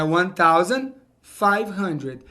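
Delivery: slow, clear English demo of the number; slow model pronunciation. Shown as a demonstration. Teacher modeling the English number for students, slow and clear. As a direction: spell it out slowly for the class. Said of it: "É assim que você vai falar".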